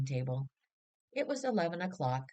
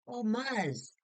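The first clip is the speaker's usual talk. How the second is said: mild mock shout; 6 semitones higher than usual.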